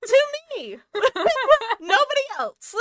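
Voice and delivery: high, loud laughing words; giggling the punchline. Real, not acted. Laughing at own joke.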